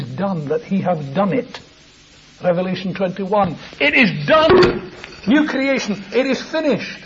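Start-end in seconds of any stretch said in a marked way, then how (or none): 3.8-7.0 triumphant cry. Acted. Preacher voicing the Saviour's cry from the cross, shouted. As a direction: cry it out at full voice. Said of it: "four of these cries".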